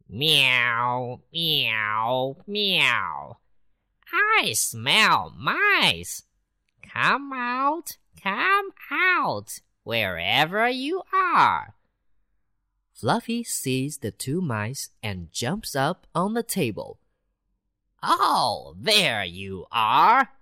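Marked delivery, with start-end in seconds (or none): 0.1-3.4 a cat announcing itself; cat meowing. Acted. Cat appears. 4.1-11.7 high, teasing and slow, calling in a rising sing-song; a cat playfully luring mice. Acted. 18.0-20.3 slow, pleased and louder; a cat delighted to spot its prey; gloating cat. Acted. Cat finds mice.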